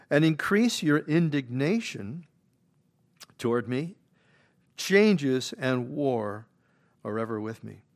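loud, slow preaching of the verse; preacher booming out Job's words, loud and slow; scripture read at full force. Acted. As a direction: proclaim each line like a pulpit reading.

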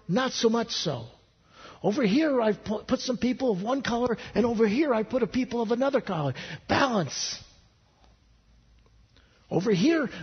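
high, lively showman of a creator; lightly voiced proud God. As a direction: show off creation like a proud maker. God explaining the world, joke.

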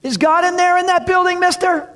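put-on high, loud boy calling from afar; eager kid hollering for attention; boy yelling. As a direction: shout across the fence like a kid. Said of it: "He's like".

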